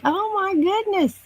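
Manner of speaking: squeaky, amazed oh my goodness; high surprised. Real, not acted. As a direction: gasp it out in disbelief. Reacts to a viewer staying up.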